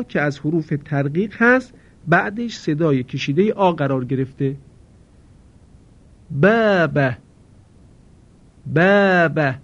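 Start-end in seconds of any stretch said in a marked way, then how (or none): 6.3-9.5 باب with a thin, light long آ; correct باب. Shown as a demonstration. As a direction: say باب with the آ kept light and narrow. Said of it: "نباید صدای کشیدهٔ آ رو در اینجا درشت".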